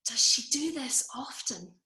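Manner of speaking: voiceless, projected whisper of horror; stage-whisper it, appalled; shocked stage whisper. Acted. Horrified mother-in-law to appalled husband about drunk narrator, shocked stage whisper. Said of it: "stage whisper in shocked tones".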